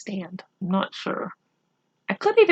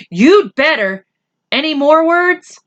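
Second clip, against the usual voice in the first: put-on higher, louder warning as a boy; 9 semitones higher, 4 dB louder than usual. Adil warning his friend to change the name, raised and sharp. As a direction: push the point as a threat.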